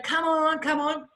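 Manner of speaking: high, drawn-out pleading call; urging onlooker. Retold or imitated. Person inside the plane to student, urging her on. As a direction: imitate someone coaxing from inside.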